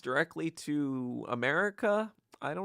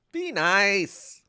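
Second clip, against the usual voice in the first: imitated sister; 8 semitones higher, 6 dB louder than usual. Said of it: "other times she's like".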